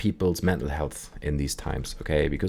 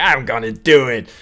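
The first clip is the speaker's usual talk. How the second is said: loud, higher mock-stubborn shout; defiant insistence; 5 semitones higher, 8 dB louder than usual. Sven insisting to his doubter in the story, loud defiance. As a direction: dig in harder, acting it out.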